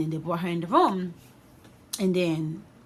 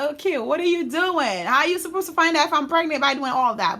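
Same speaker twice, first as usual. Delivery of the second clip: higher, louder, incredulous questioning; bewildered protest; 9 semitones higher, 6 dB louder than usual. Retold or imitated. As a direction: question it in exaggerated disbelief. Bewildered at mom's checking, acted out.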